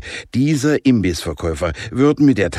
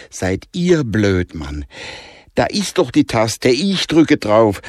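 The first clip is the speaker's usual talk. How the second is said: Danica takes charge, higher and louder; bossy girl; 4 semitones higher, 4 dB louder than usual. Acted. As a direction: scold the boys and just do it. Danica übernimmt das Kommando. (Danica kommandiert die Jungs, resolut und laut)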